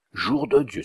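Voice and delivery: loud, high oath; the marquis points at the cat and cries out; a startled outburst; shocked exclamation. A narrator's reading. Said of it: "s'écria le marquis".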